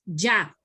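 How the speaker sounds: ya with a clear palatal ʝ. Shown as a demonstration. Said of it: "la pronunciación es".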